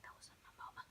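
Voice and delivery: hushed, breathless whisper; scared whisper. Retold or imitated. Turning away from mom, a frightened whisper. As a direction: whisper it so mom can't hear. Turned around, scared aside.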